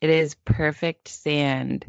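slow, deliberate meditation-leader voice; solemnly soothe, pausing between phrases; mock guided meditation. Host reassuring crab-wary co-host, slow mock meditation.

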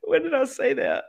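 high-pitched, laughing through the words; real laughter in the voice; laughing question. Real, not acted. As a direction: ask it while cracking up. Cracking up at the joke.

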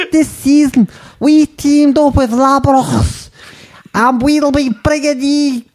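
squeaky hammed-up Scouse ad read; attempted Scouse accent. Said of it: "I'm gonna do it in Scouse".

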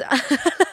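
brief, high laugh; short laugh. Real, not acted. Laughing about everyone else's gowns at dinner, amused short laugh. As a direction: let a quick laugh slip out.

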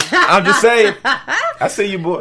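high, loud laughing hype. Real, not acted. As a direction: laugh while cheering him on. Hyping up Tariq, laughing.

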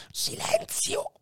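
soft unvoiced whispered hush; murmured hush. Dying Faria hushes Edmond, whispered. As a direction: breathe it, barely there. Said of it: "mormorò il moribondo".

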